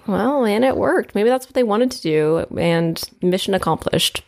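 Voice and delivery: usual speaking voice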